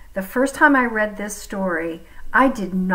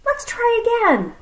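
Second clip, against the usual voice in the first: high, quick eager snake; 14 semitones higher than usual. Acted. Snake keen to try catching again, high and quick. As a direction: bounce back keen.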